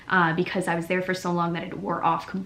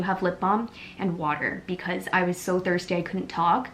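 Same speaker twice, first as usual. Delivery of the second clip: normal talking voice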